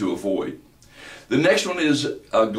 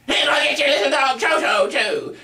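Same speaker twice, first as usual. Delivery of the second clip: high-pitched, piercing witch shriek; Wicked Witch. Shown as a demonstration. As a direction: strident voice that goes right through you. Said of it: "as the Wicked Witch in The Wizard of Oz".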